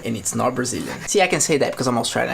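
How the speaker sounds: usual speaking voice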